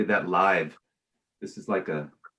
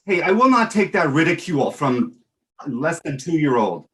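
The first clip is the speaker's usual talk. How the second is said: high, loud protest with put-on offence; mock indignation; 6 semitones higher, 5 dB louder than usual. Host objecting to ridicule from co-host, mock indignant. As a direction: protest loudly, as if deeply insulted.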